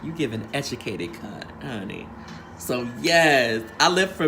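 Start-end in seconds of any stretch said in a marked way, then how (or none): 0.0-2.1 sassy aside. Retold or imitated. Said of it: "I was like".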